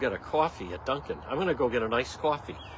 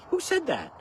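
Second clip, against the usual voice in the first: usual speaking voice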